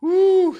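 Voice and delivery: loud, high "woo"; excited whoop. Real, not acted. Streamer reacting to a busy day, loud whoop. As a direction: let out a sudden high whoop.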